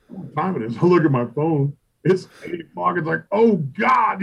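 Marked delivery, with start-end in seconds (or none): 3.3-4.2 high, loud startled "oh God"; retold panic. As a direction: blurt it out in sudden alarm. Realizing he overslept again.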